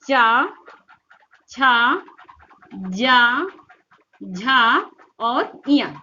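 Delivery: चा, छा, जा, झा one at a time, each held; articulation demo. Shown as a demonstration. Teacher showing the tongue's place, चा, छा, जा, झा stretched singly. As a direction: draw out चा, छा, जा, झा with gaps, then और इया. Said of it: "आपकी जीवा कहाँ जा रही है".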